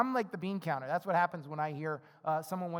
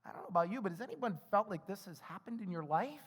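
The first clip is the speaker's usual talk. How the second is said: usual speaking voice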